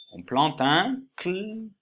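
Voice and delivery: On plante un cl said slowly, stopping on cl; slow teasing hint. Shown as a demonstration. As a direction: drag the hint out so they guess. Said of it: "je vous fais deviner". (indice lent)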